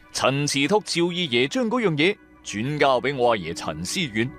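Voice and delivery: plain reading voice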